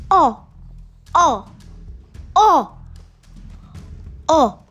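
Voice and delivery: pirate crew awed by the shadow show; transfixed oohs. A narrator's reading. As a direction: gasp in wonder over and over. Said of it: "said the crew, totally transfixed".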